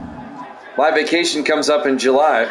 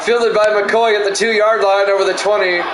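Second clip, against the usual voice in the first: play-by-play call; 3 semitones higher than usual. Acted. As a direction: call the kick return with a lift. Play-by-play of game.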